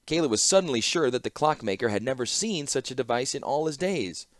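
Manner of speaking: ordinary delivery, nothing marked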